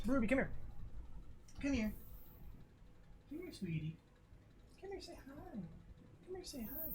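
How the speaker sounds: sweet pet talk to a shy puppy; soft high coaxing voice calling the dog over. Real, not acted. Coaxes his puppy on stream.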